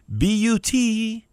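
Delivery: B U T, each letter slow and separate; preacher spelling out BUT to stress the turn, slow and deliberate; spelled out. Acted. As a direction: spell it out slowly for weight.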